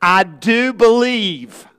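slow, loud heartfelt plea; crying out. Retold or imitated. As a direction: cry it out like a desperate father. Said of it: "moved this father to cry out".

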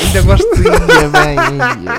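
high, loud delighted squeal; excited squeal. Real, not acted. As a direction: gush over the car. Excited reaction to the photo. (entusiasmado aos gritos)

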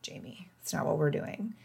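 soft whispered self-correction; gently scolding herself in a whisper; self-talk whisper. Retold or imitated. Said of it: "I started to realize".

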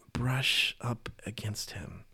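slow breathless whisper. Retold or imitated. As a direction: whisper it as a secret longing. Woman's hidden hope in crowd.

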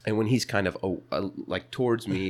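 ordinary talk

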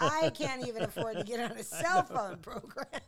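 high, loud, laughing indignation; retold outrage. Broke and envying someone's cell phone, comic outrage. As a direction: protest loudly through the laughter, hammed up. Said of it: "I was so pissed".

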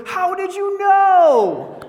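high, loud, drawn-out squeal of disbelief; mock amazement. Husband feigning amazement at his wife's restaurant guess, mock amazed squeal. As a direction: exclaim it like an astounded husband, way up high.

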